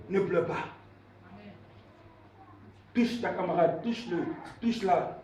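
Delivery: soft, low words of comfort; gentle consoling. Real, not acted. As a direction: hush the congregation into comforting her. Preacher consoles a weeping woman. (consolation douce, à voix basse)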